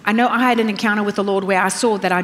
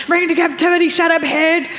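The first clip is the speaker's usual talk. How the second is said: mock self-scolding; 6 semitones higher than usual. Preacher retells rebuking her own anxious mind, half-laughing. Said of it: "still having to go".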